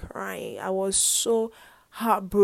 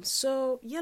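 ordinary talk

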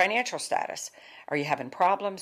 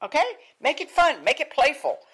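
much higher, bright coaxing; playful urging; 10 semitones higher, 4 dB louder than usual. Real, not acted. Coach urging the group to make it playful, high and lively. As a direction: cheer them on to make it fun.

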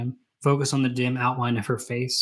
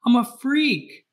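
higher, slow, anguished self-loathing; anguished cry; 9 semitones higher, slower than usual. Retold or imitated. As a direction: wail it out slowly, voice pitched up high. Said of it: "he says".

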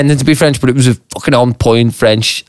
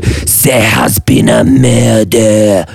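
dramatic announcement; 3 semitones lower, much slower than usual. Pretend.

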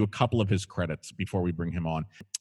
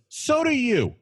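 retort; 13 semitones higher, 3 dB louder, slower than usual. Retold or imitated. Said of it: "Lucy looks at her and goes".

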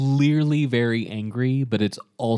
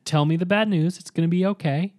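lightly voiced soothing villain, raised pitch; mock reassuring; 8 semitones higher than usual. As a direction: coax him gently like a brother. Host voices villain's reassuring hug.

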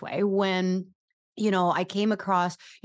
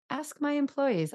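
normal talking voice

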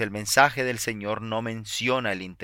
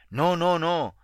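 quick, raised and louder no no no; emphatic denial; 8 semitones higher, 5 dB louder, slower than usual. A narrator's reading. Woman correcting the preacher, an emphatic raised denial. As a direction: insist she didn't mean that. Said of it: "dijo ella".

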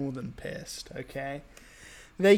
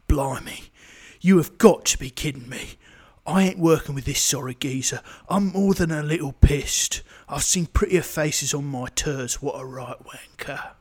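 lightly put-on Cockney bruiser voice; a hint of a London geezer sneering at his rival; British tough guy. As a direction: play it like a scornful British hardman, half-joking. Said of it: "I'll be Shaw".

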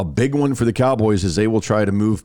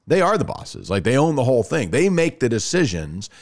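ordinary delivery

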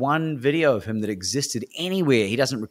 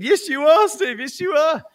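high, loud fervent affirmation; evangelical cheering; 6 dB louder than usual. Retold or imitated. Speaker cheering on his coach, evangelical and loud. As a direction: preach it back like a believer. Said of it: "I was getting evangelical at this point".

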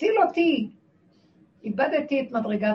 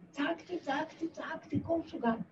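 high but hushed, much softer; soft, raised-pitch reliving; retold crying out; 5 semitones higher, 9 dB quieter than usual. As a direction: recall the cries in a small, high voice. Retells crying out alone in fear.

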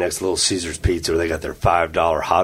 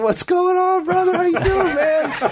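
much higher, put-on buddy voice ending in a laugh; hammed-up cheery hello with a chuckle; mock friendly greeting. Greeting the agent who arrested him, high mock cheer. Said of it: "I was like this. I said".

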